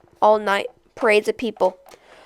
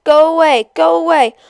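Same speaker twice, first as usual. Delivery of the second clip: crowd yelling, higher and louder; shouted; 5 semitones higher, 4 dB louder than usual. Acted. Courtyard crowd shouts down the old man, loud and angry. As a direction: drive him off with a shout. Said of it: "Many shouted one, at once".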